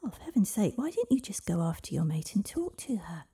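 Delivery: high-pitched, swooping exasperation; exasperated. Acted. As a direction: burst out in impatient disbelief. Girl exasperated with the dragon.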